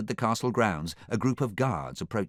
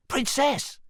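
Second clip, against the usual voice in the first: excited high cry; Donkey; 4 dB louder than usual. A narrator's reading. Donkey greets the princess with a high excited cry. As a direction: call out, pitched way up. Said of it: "cried Donkey".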